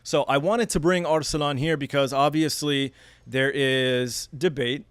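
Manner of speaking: ordinary talk, nothing marked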